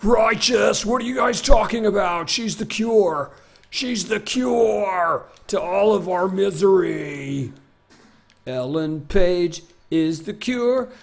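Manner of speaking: stoner dude. Pretend. Continues dude voice.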